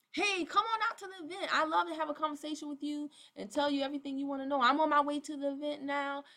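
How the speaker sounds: high, lively put-on sales push; imitated bubbly event invite; mock eager invitation. Acts out recruit's insistent invite.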